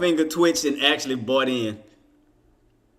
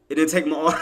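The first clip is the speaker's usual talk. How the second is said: normal talking voice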